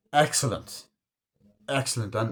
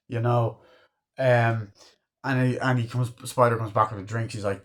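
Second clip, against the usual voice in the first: ordinary talk, nothing marked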